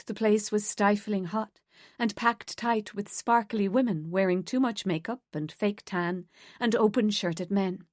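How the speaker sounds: usual speaking voice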